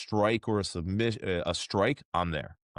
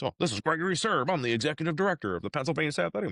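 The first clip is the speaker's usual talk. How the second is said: quick higher grumble, rattling off his title; imitating a grumbling official; 8 semitones higher, much faster than usual. Commission director phoning a nobody applicant, fast mock grumble. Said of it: "And he's grumbling and he's, like, rattling off".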